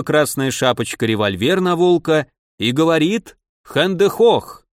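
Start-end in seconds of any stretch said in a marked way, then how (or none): 3.6-4.7 mock German command. A narrator's reading. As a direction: bark it like a movie German soldier. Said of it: "По-немецки это означает". (хэндехох, медленно и громко, с немецким акцентом)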